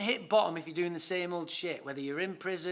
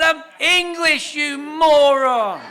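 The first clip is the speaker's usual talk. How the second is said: indignant shout; 9 semitones higher, 15 dB louder, much slower than usual. Retold or imitated. Retold outburst correcting police officers.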